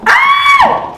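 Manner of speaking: short, very high, loud shriek of delight; excited shriek. Real, not acted. Opening the designer box, a sudden high delighted shriek. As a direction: yelp as the first item appears.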